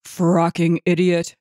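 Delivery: slow, contemptuous insult; mild narrated shout. A narrator's reading. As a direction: draw out the insult in irritation. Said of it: "Roy shouted".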